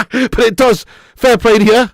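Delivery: high, laughing through the words. Real, not acted. Streamer teasing a viewer about his shirt, laughing.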